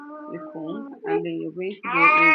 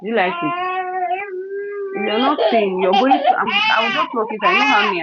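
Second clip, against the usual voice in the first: loud, higher threat shouted in frustration; frustrated shout; 12 semitones higher, 5 dB louder than usual. Real, not acted. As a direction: raise the voice to threaten. Shouting at crying child.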